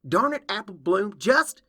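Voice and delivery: loud, high burst of frustration; exasperated shout. Acted. Applejack blocked and ignored by the filly, exasperated shout. Applejack loses patience, is cut off.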